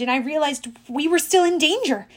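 usual speaking voice

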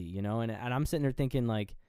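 ordinary talk

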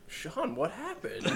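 slow, hushed mock shock; imitated stunned question. Brandon asking Sean what happened, imitated stunned hush. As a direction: ask it softly and slowly, acting floored. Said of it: "Brandon's eyes get fucking huge".

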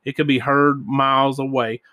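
plain reading voice

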